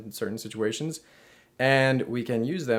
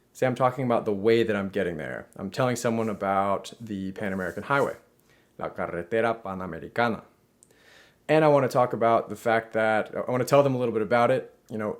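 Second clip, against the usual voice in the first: normal talking voice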